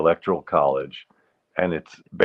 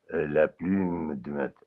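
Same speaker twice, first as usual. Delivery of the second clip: slow, hesitant German-accented French; 2 semitones lower, 6 dB quieter, slower than usual. Shown as a demonstration. Testing out an accent, hesitant German-accented French. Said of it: "do French with a German accent".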